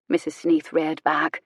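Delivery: ordinary delivery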